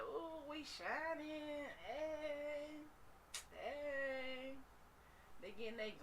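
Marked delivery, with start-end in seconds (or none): 0.0-4.5 high, slow, gleeful little tooth voice; happy dancing teeth. Acted. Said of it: "They like".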